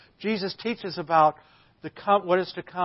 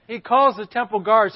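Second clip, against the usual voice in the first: ordinary talk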